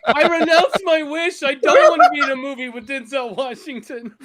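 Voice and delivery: high, loud comic pleading; mock panicked plea. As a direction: beg to be let out, hammed up. Begging director to escape the role.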